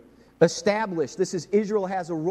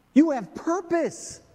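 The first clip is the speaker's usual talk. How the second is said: slow, much higher and louder proclamation; proclaiming; 13 semitones higher, 5 dB louder, slower than usual. Acted. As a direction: declare it over them, slow and strong. Emphatic repeated declaration.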